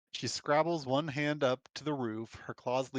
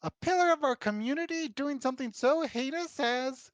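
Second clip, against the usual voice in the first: outraged aide; 13 semitones higher, 4 dB louder than usual. Acted.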